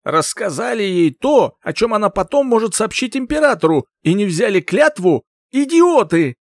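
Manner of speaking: a raging king berating his idiots; roaring tyrant king. Acted. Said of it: "Король взревел".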